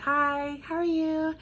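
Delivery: fake high greeting. Refusing to fake it, she mocks a high sweet greeting. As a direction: hit a fake high sugary voice. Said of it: "I'm not gonna be like".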